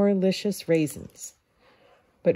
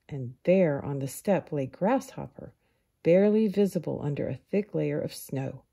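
usual speaking voice

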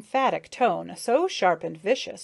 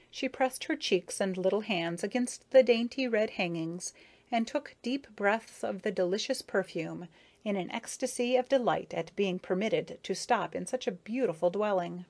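ordinary delivery, nothing marked

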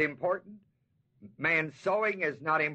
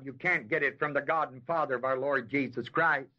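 ordinary delivery

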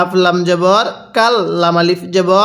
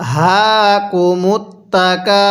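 each syllable drawn out slowly; slow demo reading; much slower than usual. Shown as a demonstration. Teacher demonstrates the verse slowly for students. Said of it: "তাহলে কিভাবে পড়ব?".

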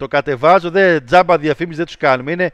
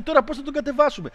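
mock rivals; 8 semitones higher, 6 dB quieter, faster than usual. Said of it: "σου λέει".

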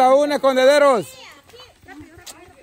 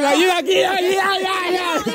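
higher-pitched mock crowd calls; imitating crowd shouting; 8 semitones higher than usual. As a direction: mimic the players all yelling for help. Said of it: "el montón de gritando".

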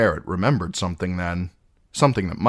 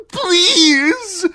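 very high, loud wailing plea; desperate begging; 11 dB louder than usual. Acted. Condemned captive begging outlaws, desperate wail. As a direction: wail it, begging for your life. Condemned man begs for life.

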